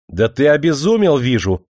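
loud, sharp rebuke; angry outburst. Acted. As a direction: lunge at him with the words. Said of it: "набросился на него Демидов". (гневно набрасываясь)

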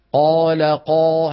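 long drawn heavy alif in قال قائل; slow demo. Shown as a demonstration. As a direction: stretch the alif in قال قائل full and heavy after the qaf. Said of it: "هالألف هذه تبعت فتحة القاف".

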